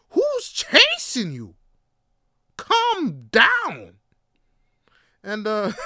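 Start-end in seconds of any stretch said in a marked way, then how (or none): 0.1-1.5 very high, loud, stretched-out yell; escalated exasperated yell. Acted. Repeats the outburst, escalating. 2.6-3.9 high, loud plea to slow down; exasperated yell. Acted. Yelling at the driver to slow.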